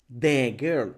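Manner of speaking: put-on "the girl" with "the" as a full deh; wrong "deh". Shown as a demonstration. Teacher shows another mistake, deh girl. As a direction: give "the" a clear eh before "girl". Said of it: "No, practícalo".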